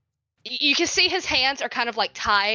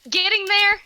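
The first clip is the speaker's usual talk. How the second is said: more feeling; 10 semitones higher, 6 dB louder than usual. Shown as a demonstration. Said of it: "What's more with feeling?".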